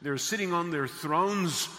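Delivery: loud, ringing pulpit delivery; full-force preaching. Real, not acted. Preacher describing God's throne to the congregation, full-force preaching. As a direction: proclaim the vision to the whole congregation.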